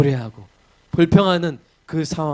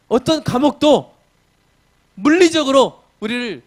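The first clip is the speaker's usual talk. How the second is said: higher and louder, driving the point; full-force preaching; 7 semitones higher, 4 dB louder than usual. Acted. Preacher telling the congregation of Paul's joy, fiery preaching. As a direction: preach it with fire.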